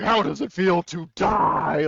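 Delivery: slow, high and loud, like a gloating villain; menacing villain quote. Retold or imitated. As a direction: savour each word as the killer. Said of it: "Grievous killing that one Gungan guy".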